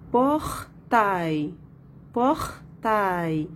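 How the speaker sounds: "portail" in two beats, por, pause, tail; split into syllables. Shown as a demonstration. Teacher locating the sound in second syllable, split into syllables. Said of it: "dans la deuxième syllabe".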